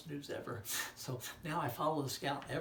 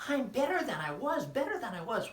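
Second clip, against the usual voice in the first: high, loud squeaky puppet; 11 semitones higher, 11 dB louder than usual. Acted. Puppet friend greeting the children, high and loud. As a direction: pop up cheerfully as a puppet.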